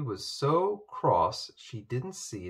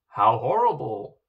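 disgusted; 8 dB louder than usual. A narrator's reading. Queen's disgusted outburst.

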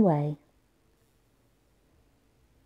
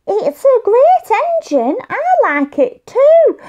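put-on high, bright-pitched little boy voice, louder; high child voice; 12 semitones higher, 6 dB louder than usual. Tom forgives Josh, high little boy voice. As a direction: play Tom as a small happy boy.

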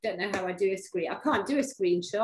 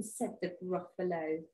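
usual speaking voice

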